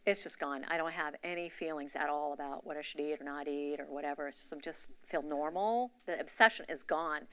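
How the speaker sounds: ordinary delivery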